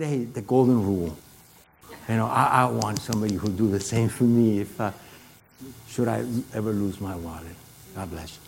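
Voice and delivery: soft humble reply fading out; humble and quiet. Retold or imitated. His humble reply to the man.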